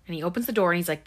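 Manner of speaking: ordinary delivery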